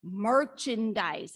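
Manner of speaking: slow, deliberate Merchandise; slow clarifying Merchandise; spelled out slowly. Retold or imitated. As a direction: stretch out Merchandise patiently, syllable by syllable. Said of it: "I don't know what you're saying".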